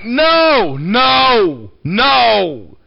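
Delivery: loud, high repeated no's; panicked refusal. Retold or imitated. Telling the church he refused to answer, loud and high. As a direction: shout no as the phone shows that number. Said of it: "And I'm like".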